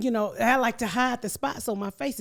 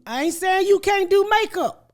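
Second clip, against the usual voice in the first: high, loud retort retold with force; emphatic confrontation; 9 semitones higher, 8 dB louder than usual. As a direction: act out telling someone off, loud and high. Acted confrontation with makeup artist.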